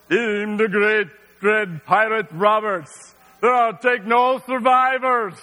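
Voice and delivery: slow, menacing pirate boast; Dread Pirate Roberts. Retold or imitated. Flaming Andre boasting to the guards, slow pirate menace. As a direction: drag out a villain's threat. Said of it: "and he's like".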